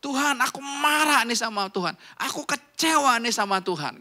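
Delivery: high, emphatic mock protest; acted anger at God. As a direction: demonstrate venting straight at God. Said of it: "Kamu mau marah Tuhan, marah aja".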